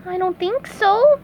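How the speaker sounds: high squeaky sponge; bright cartoon sponge voice; SpongeBob voice. A narrator's reading. SpongeBob character voice.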